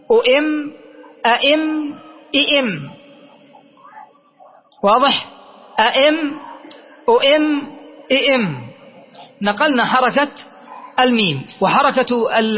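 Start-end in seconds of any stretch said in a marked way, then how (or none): 0.1-2.9 أؤم، أئم، إئم as u'imm, a'imm, i'imm; merged mim. Shown as a demonstration. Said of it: "نقل حركة الميم من أجل الإدغ". 5.8-8.7 أئم، أؤم، إئم as a'imm, u'imm, i'imm; merged mim. Shown as a demonstration. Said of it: "نقل حركة الميم من أجل الإدغ".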